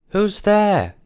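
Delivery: put-on high, loud child calling out from the dark; a small boy calling out, startled awake; child's voice. Boy in hidden room calls out, high child's voice. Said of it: "a child's voice rang out".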